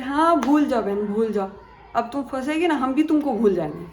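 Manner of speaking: sarcastic scolding. Retold or imitated.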